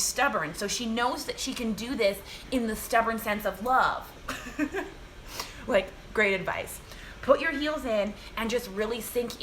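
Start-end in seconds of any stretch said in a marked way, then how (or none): 4.1-5.6 breathy little laugh; airy giggle at the joke; soft laugh. Real, not acted.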